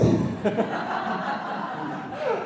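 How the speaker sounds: laughing hard at his own joke; high airy burst of laughter; real laughter after the punchline; high breathy laugh. Real, not acted.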